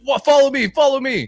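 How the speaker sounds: high, loud amused order; imitated laughing command. Civilian walking the bad haircut around, imitated amused command. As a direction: mimic someone cracking up as they give orders. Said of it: "dude, just dying laughing".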